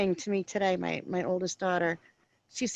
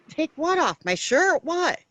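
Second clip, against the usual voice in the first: high, loud bewildered questions; shocked disbelief; 7 semitones higher, 4 dB louder, slower than usual. Retold or imitated. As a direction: act stunned by the order. Mom retells shock at daughter.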